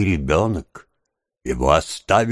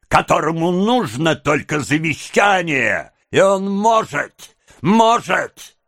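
high, slow, frenzied tirade; furious high-pitched outburst, words dragged out; beside oneself with rage; 10 semitones higher, slower than usual. A narrator's reading. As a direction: rant at high pitch, stretching every phrase. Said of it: "потеряв голову от бешенства".

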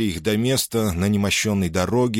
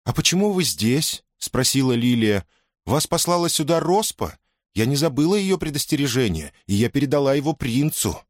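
higher-pitched female character voice; questioning young heroine; 6 semitones higher than usual. A narrator's reading. Lilia questions the forest women, higher character voice.

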